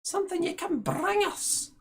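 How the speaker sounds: put-on high old hag approving; crone voice. Ethel praises her sister's plan, high crone voice.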